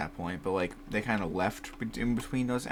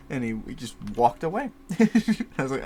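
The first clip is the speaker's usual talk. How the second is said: normal talking voice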